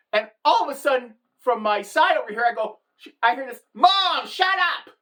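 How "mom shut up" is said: high, loud daughter cutting mom off; mock an embarrassed daughter's raised-voice outburst; exasperated outburst. Daughter hushing mom at dinner, exasperated outburst.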